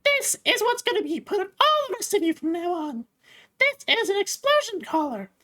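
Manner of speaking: high, menacing warden. Acted. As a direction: squeak out the threat up high. Warden explains collar.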